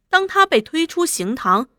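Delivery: ordinary delivery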